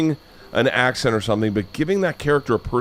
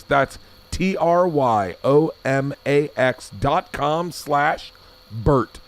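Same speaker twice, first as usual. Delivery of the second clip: slow spelled ad read; 2 semitones higher, much slower than usual. Shown as a demonstration. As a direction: spell the URL very slowly. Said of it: "I'm not reading this".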